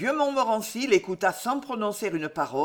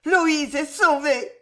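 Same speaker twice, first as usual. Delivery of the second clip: higher, louder and slow, triumphant; exalted cry; 7 semitones higher, 5 dB louder, slower than usual. Acted. Mother cries out that her daughter is saved, high and loud. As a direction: let the relief ring out. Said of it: "s'écria Jeanne avec exaltation".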